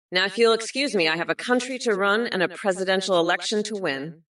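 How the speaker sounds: usual speaking voice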